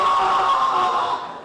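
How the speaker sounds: loud, hammed-up toddler shriek; child's scream. A little girl meeting Minnie Mouse, mock shriek. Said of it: "and just goes".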